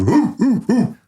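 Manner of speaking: high-pitched imitation dog woofs; dog barking. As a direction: bark like a dog in short high woos. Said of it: "Bowser goes".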